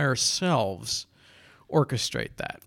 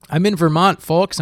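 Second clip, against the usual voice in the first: loud announcement; 3 semitones higher, 7 dB louder than usual. Real, not acted. Announcing he is in Vermont.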